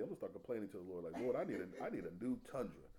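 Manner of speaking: quiet mock complaint to the Lord; mock grumbling. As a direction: mutter it like a sulky complaint.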